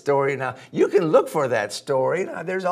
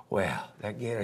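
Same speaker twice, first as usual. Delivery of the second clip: ordinary delivery, nothing marked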